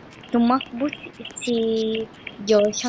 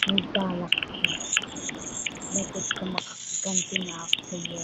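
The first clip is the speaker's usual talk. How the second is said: sinister villain; 4 semitones lower, 6 dB quieter than usual. Acted. Villain announces revenge plan.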